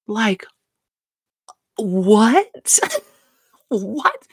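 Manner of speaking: high, disbelieving cries of what; shocked what. Real, not acted. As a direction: react in shock, breaking into a laugh. Shocked by Reza's question.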